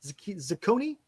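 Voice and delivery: falter, then ask "Zacconi" with CO in the middle; hesitant pronunciation. Real, not acted. Host retrying a player's name, hesitant pronunciation. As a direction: restart "Zacconi" softly, landing on za-CO-nee with a long o. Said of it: "Not sure how you pronounce his name".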